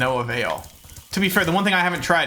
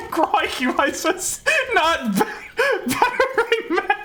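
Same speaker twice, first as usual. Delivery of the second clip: much higher, slow, laughing through it; laughing mock accent; much slower than usual. Real, not acted. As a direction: giggle out the mock Aussie exclaim. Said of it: "Australian slang while laughing".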